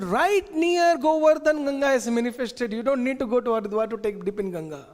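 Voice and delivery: put-on an excited little boy sharing news; child Krishna. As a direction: son excitedly telling his father about Ganga. Child Krishna tells Nanda Baba.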